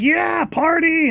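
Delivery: high, slow, drawn-out cheer; mock party cheer. Partygoers cheering the mine trip, hammed-up high cheer. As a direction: squeal it out like a crowd thrilled to party. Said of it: "And so everyone's like".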